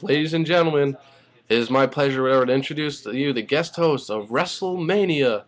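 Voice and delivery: mock ring-announcer voice, drawn up high; Justin Roberts. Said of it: "Justin Roberts is in the ring. He says".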